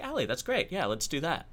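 mock cheerful agreement. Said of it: "someone's just like".

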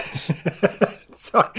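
laughing nervously through it. Real, not acted. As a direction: apologise while giggling. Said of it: "Just like a nervous laughter".

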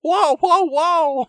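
high, loud, drawn-out whoas; hammed-up startled protest; mock alarm. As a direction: back off in comic panic. Reacting to a drawn shotgun.

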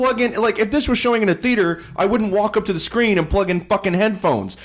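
high-pitched, indignant complaint; exasperated rant. Real, not acted. Film critic ranting at a film he couldn't hear, exasperated. Heated rant about inaudible dialogue.

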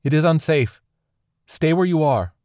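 high, loud warning; entity calling out. A narrator's reading. Wounded alien tells survivor to stay back, calling out. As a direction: call a warning across the field. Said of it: "the entity called out".

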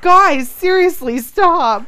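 high, loud, slowed plea to stop; drugged-up plea. Retold or imitated. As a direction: beg them to stop, woozy and drawn out. Drugged up, pleading the fight stop.

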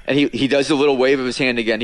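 ordinary talk, nothing marked

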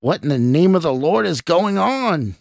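high, exasperated outcry; baffled, swooping cry; shouting. A narrator's reading. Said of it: "Scott shouted".

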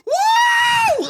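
loud, piercingly high drawn-out whoop; cheering woo. Retold or imitated. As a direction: imitate girls still whooping in the background. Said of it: "still going like".

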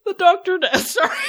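high, breaking into giggles; laughing. Real, not acted. Author cracking up mid-sentence, laughing. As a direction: lose it on the words.

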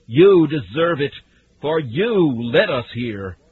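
loud, jeering accusation; taunting shout. Acted. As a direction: hurl it at the seducer. Damned souls taunting the seducer.

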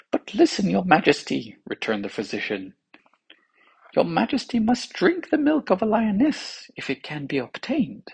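put-on high, eager young physician voice. A narrator's reading. Young physician tells the king he is bewitched, high voice.

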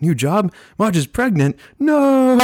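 Homer's mock panic, climbing to a shriek; shocked realisation. Homer realises Marge is pregnant.

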